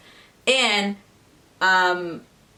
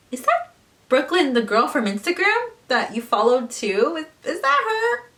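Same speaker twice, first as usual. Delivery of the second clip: high, sing-song fake innocence; mock innocent; 6 semitones higher than usual. Confronting her boyfriend about the girl in high fake sweetness. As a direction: ask sweetly while setting a trap.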